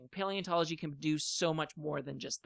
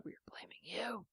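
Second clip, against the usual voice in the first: hushed, breathy warning; whispered threat; 3 semitones higher, 14 dB quieter than usual. Acted. Mock threat over song ban.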